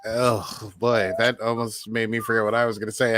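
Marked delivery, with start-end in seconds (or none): none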